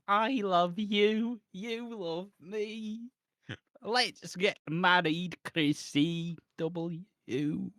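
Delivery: slow, drawn-out sing-song proposal; hammed-up Barney rhyme. Mocking a soap proposal as a Barney song, slow sing-song. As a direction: stretch every word like a kids' TV song. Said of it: "I'm hyping it up".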